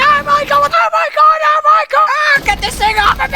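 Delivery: panicked screaming. Acted. Boat disaster, panicked screaming.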